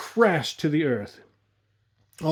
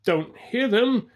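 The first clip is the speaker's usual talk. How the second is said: yelled back; 8 semitones higher, slower than usual. A narrator's reading. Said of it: "Tom yelled back".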